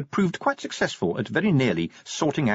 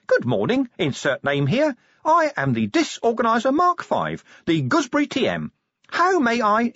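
high chirpy gadget imp; fast, high and eager; squeaky imp voice; 13 semitones higher, 4 dB louder than usual. Acted. Imp character voice.

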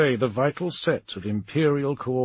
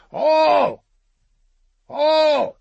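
high, loud shout of a name; calling out; 10 dB louder than usual. A narrator's reading. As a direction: call to someone from far off. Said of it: "it was a real voice".

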